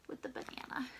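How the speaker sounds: whispering. A narrator's reading. Hushed storytelling to children.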